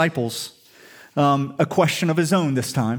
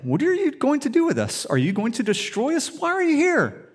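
frightened demons; 8 semitones higher than usual. Retold or imitated. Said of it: "They're like".

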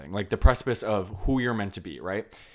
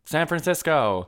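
slow, high, louder resigned answer; deflated; 7 semitones higher, 7 dB louder, slower than usual. Retold or imitated. Lady Bird caving and naming a cooler city, deflated. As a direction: sigh it out, giving up. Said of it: "rolls her eyes and, like, deflates herself".

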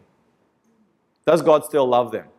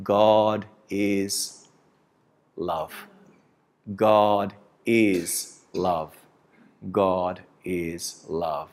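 slow, much softer, lowered voice dwelling on each word; the closing refrain of a sermon, drawn out slow and soft; soft reverent refrain; 6 semitones lower, 6 dB quieter, much slower than usual. Acted. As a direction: let it fade like a hushed hymn line. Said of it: "And the theme song will be".

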